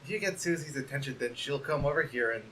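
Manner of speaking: timid hushed valentine; soft shy letter. Acted. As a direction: read it gently like a bashful friend.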